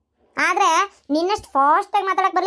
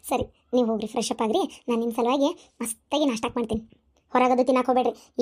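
sweet coaxing; 6 semitones lower than usual. Acted. Woman offering to cook breakfast, tenderly coaxing. Sweet-talking the man after quarrel.

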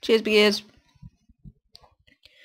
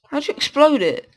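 higher, exasperated repeat; how'd you explode it, pitched up in disbelief; incredulous; 7 semitones higher than usual. Real, not acted.

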